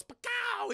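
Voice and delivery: high-pitched "pacow" sound effect; imitated blast noise. Streamer imitating Raiden's strike, imitated blast noise. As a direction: mimic a zap with your voice.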